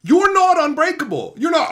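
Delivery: loud, heated rant at a viewer; angry yelling. Real, not acted. Streamer furiously yelling at a sick viewer. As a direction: really chew him out.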